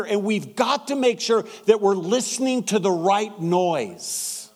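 preaching. Acted. Pastor rallying his church to go where people are, preaching. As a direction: drive each word home to the congregation.

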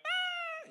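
mock baby scream; imitated toddler wail. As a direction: shriek like a startled toddler. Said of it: "he cried".

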